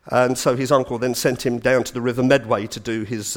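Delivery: ordinary talk, nothing marked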